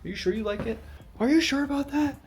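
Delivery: high teasing ghost voice, lightly done. Pretend. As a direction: ask it like a doubting spirit. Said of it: "Ghost is gonna come out and be like".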